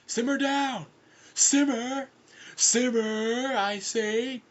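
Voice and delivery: high, insistent shouted order; stern yelling. Acted. Foyle ordering the screaming pair to settle, stern high yell. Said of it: "Foyle sternly said".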